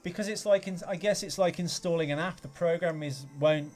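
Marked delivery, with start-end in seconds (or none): none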